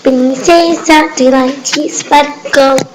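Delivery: slow, grand self-introduction; slow formal title. Acted. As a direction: pronounce the title slowly and proudly. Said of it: "correcting with full title". (apresentação solene e lenta)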